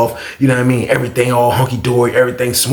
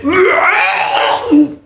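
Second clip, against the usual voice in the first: high shouted retching gag; mock retching. Imitating the guy throwing up, high shouted gag. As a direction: let out a big high gag.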